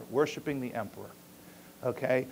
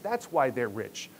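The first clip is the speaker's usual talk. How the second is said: ordinary talk, nothing marked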